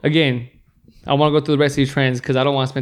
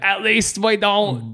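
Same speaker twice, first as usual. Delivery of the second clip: mock British accent; 6 semitones higher, much slower than usual. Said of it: "making fun of British people".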